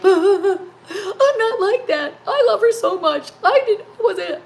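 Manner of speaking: high, loud, wailing re-enactment of crying; mock sobbing. Retelling her private cry while picking tomatoes, mock sobbing. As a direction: wail it through hammed-up sobs. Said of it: "my tears were sobbing".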